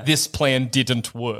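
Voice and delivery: low, slow sea witch lament; low slow disappointed Ursula. Retold or imitated. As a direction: drop deep and drag it out like a sulking villain. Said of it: "Ursula's like".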